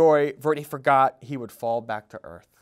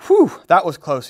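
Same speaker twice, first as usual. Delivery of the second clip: louder, breathless relief after escape; sputtering relief; 3 semitones higher, 9 dB louder than usual. Acted. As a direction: lega tergagap setelah lolos. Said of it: "he sputtered".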